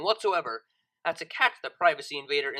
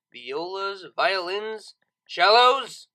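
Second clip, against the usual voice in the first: usual speaking voice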